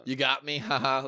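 good-sport laugh. Pretend. Prank victim laughing along with the pranksters.

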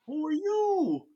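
high, drawn-out mock fright; imitated terrified squeak; frightened question. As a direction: stretch it out high and slow, scared. Frightened retelling to the Reaper.

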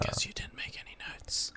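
teasing whisper under the breath; stage-whisper the dig; whispered jab. Real, not acted. Teasing aside about missing notes.

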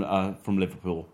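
usual speaking voice

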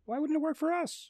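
solemn lecture about lobsters; Jordan Peterson impression. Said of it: "scarily good impressions from both of you".

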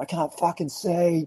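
high-pitched mock yell, held back in volume; retold aggro scream. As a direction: imitate an angry friend's wail. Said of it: "he was just screaming his head off".

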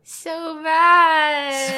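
high, loud, whiny "so bad"; dramatic groan. Real, not acted. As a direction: wail it like a drama queen. Answering how her week goes.

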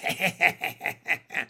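long staccato raspy laugh; gloating chuckle; bullfrog cackle. A narrator's reading. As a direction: cackle like a nasty frog. Bullfrog laughs at his taunt.

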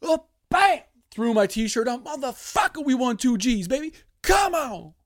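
very high, loud victory yell; wild celebration. Retold or imitated. Fan throwing on a shirt after winning, loud high yelling. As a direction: shout like you just won big. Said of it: "my fucking reaction".

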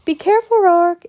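put-on high, loud girl's call; child calling after someone far away; shout. A narrator's reading. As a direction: shout a caring warning skyward. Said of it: "Annie shouted".

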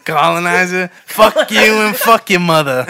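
mock Boston insult at a colonizer; Boston accent, an alien cursing out invaders. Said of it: "they're all talking in a Boston accent".

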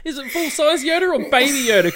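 high, loud, fast, laughing; laughing question. Real, not acted. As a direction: ask while cracking up.

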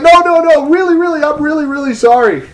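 very high, frantic mock pleading; desperate apology. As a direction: plead in a squealing, repeating tumble. Frantic renewed apology, acted.

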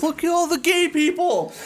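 high, excited tourist impression; mock wide-eyed amazement. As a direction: imitate a newcomer marvelling at the sight. Mimics amazed man at Pride parade.

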